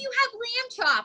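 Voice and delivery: high cutesy puppet voice. Acted. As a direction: talk high like a kid's puppet. Greets the Lamb Chop puppet.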